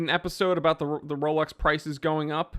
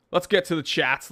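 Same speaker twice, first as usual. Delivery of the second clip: ordinary talk, nothing marked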